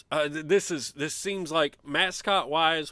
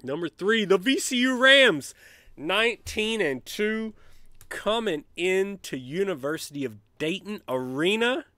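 mock announcer intro; 5 semitones higher, 6 dB louder than usual. Fan introducing the game to viewers, mock announcer. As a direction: hype the matchup like a PA announcer.

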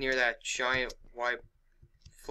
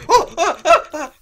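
high panicked laugh; nervous laughter; 15 dB louder than usual. Real, not acted. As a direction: laugh out of sheer nerves. Scared laugh at Herobrine.

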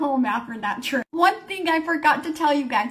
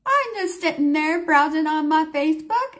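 usual speaking voice

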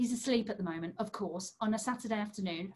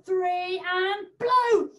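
loud countdown call; 6 semitones higher, 7 dB louder, much slower than usual. Acted. Counting kids into a big blow.

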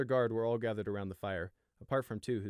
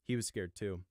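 ordinary delivery

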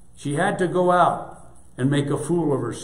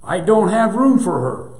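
raised voice, loud and high; shouting; 6 semitones higher, 7 dB louder than usual. A narrator's reading. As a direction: shout it at the owner. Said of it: "the son shouted".

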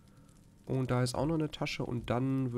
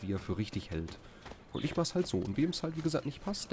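usual speaking voice

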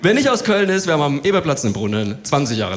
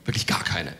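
whispered, softer confidential aside; 9 semitones lower, 5 dB quieter than usual. Real, not acted. Comedian confides to audience about neighbour, whispered. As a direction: lean in and whisper it.

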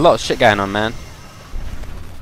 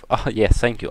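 ordinary talk, nothing marked

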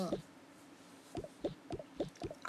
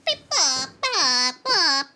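loud wailing beeps; crying robot; 11 dB louder, slower than usual. Pretend. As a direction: wail the beeps. Robot upset.

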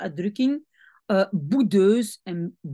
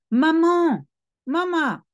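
lightly acted, higher drawn-out call; child's protest; 8 semitones higher, 4 dB louder, slower than usual. A narrator's reading. Milo objecting to his mother's order, mildly protesting. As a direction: plead lightly like a child calling mum.

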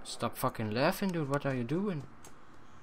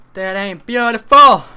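higher and louder, hammed up; loud mock proclamation; 7 semitones higher, 17 dB louder than usual. Shown as a demonstration. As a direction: belt it out for the joke. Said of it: "repeated louder".

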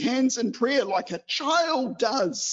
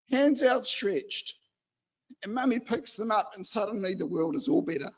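usual speaking voice

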